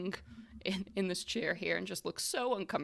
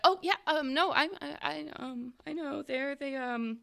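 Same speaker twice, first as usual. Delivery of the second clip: put-on high, halting, timid girl voice; mousy girl; 9 semitones higher than usual. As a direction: speak up shyly in a high, hesitant voice. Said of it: "the mousy girl will kinda, like, speak up".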